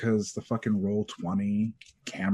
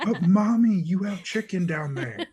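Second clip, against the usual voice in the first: lightly voiced whiny kid; child voice; 9 semitones higher than usual. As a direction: play the kid pleading to mommy. Voicing child Thomas.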